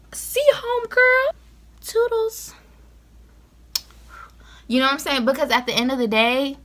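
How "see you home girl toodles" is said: high, sing-song sassy farewell; sassy goodbye. Pretend. Sassy high goodbye to anyone wanting to leave. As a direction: wave them off with a high, mocking lilt.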